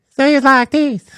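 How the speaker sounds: mocking high-pitched guy. Mimicking the gym guy for listeners, high-pitched voice. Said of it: "except he had a high-pitched voice".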